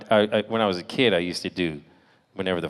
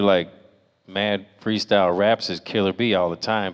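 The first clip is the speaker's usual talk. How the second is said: ordinary delivery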